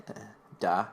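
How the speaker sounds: normal talking voice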